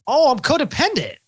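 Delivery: high, bright-eyed mock discovery, pitched well up; mock realization. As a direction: act out suddenly realizing it about yourself. Mock sudden self-discovery.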